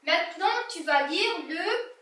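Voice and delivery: usual speaking voice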